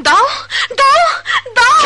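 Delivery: loud repeated cry of give; shouted demand. Acted. Woman shouts at the son to give her money back. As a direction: bang out each word.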